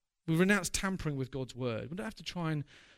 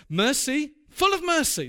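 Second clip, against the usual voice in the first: emphatic exclamation; 8 semitones higher, 6 dB louder than usual. Real, not acted. Preacher exclaims the key word.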